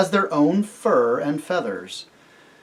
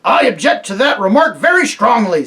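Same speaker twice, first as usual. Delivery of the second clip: louder, indignant gruff protest; offended bulldog; 6 semitones higher, 6 dB louder than usual. A narrator's reading. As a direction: bristle with offence. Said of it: "said the bulldog".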